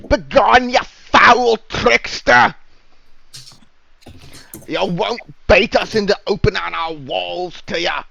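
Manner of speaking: high, loud shout from the wall. Acted. Guard shouts down from the wall at suspected tricksters, high defiant shout. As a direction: bellow a defiant warning in a high-pitched voice. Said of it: "A voice shouts back from the wall".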